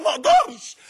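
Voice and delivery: preacher crying out ما أقدرش at the peak; impassioned cry. Real, not acted. Preacher to the youth, crying out ما أقدرش.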